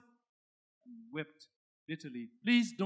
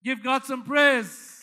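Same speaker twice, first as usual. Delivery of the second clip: slow, loud preacher call for praise; 5 semitones higher, 14 dB louder, slower than usual. Real, not acted. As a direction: rouse the church to praise. Calling for praise.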